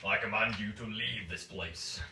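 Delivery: slow order rising to a roar; commanding, building loud. Acted. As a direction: start firm, end booming. Intimidating the kneeling cultists.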